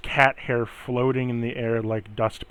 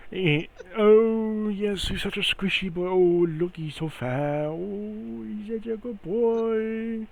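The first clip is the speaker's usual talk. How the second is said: put-on cooing baby talk; 8 semitones higher, slower than usual. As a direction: coo over him like a baby. NPC keeps cooing at cat.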